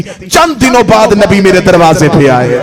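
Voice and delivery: very loud, impassioned sermon delivery; sustained shout rising to a climax; full-force preaching. Acted. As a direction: thunder it out to the congregation.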